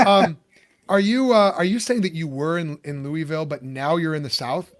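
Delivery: ordinary talk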